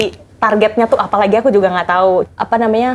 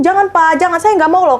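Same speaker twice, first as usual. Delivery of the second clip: high, urgent begging no to the boss; pleading refusal; 9 semitones higher than usual. Retold or imitated. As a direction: plead with him to stop. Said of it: "aku bilang gitu kan".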